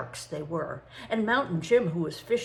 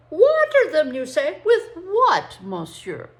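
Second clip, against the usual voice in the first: much higher, much louder, half laughing; exasperated outburst; 9 semitones higher, 11 dB louder than usual. Acted. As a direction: laugh bitterly at the absurd advice. Frenchman mocking the idea.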